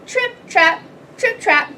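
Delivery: high, crisp little hoof steps; sing-song trip-trap, pitched high and punched; light tiny hooves tapping over a bridge; trip-trap hoof sounds. A narrator's reading.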